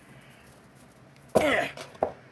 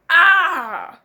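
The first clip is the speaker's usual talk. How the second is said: sharp yelp of pain, louder; pained cry; 4 semitones higher, 8 dB louder than usual. Acted. Hurt man yelping at a sudden blow. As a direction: cry out as if hit.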